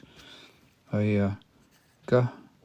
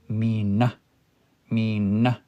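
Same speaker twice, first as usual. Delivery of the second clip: Mina stressed on the end, mee-NA, rising; stress on second syllable; slower than usual. Shown as a demonstration. Teacher modelling a two-syllable word, second syllable stressed. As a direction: hit the NA of Mina. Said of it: "the stress on the second one".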